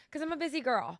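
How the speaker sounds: ordinary delivery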